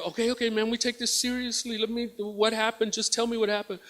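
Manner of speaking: retold officer, soft and even; calming officer. Officer taking an upset woman's complaint, softly calming. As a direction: settle the complainant down quietly.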